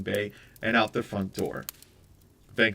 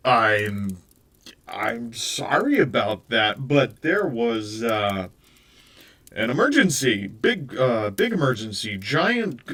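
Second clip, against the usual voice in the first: flustered excuse; 6 dB louder, slower than usual. Acted. Venkman fumbles a flustered excuse.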